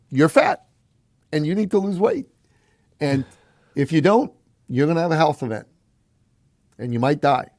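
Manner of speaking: high, loud, blunt warning; blunt pitch. Retold or imitated. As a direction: hammer them with the hard truth. Blunt sales pitch to clients.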